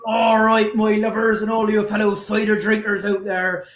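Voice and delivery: broad West Country yokel greeting; hammed-up Somerset farmer welcoming the cider crowd; West Country accent. Guest greets the listeners in a put-on West Country accent. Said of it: "I'm not gonna do that accent".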